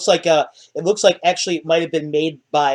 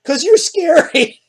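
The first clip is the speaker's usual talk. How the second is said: put-on scared little girl; 12 semitones higher, 5 dB louder, slower than usual. Frightened girl answers Agrias.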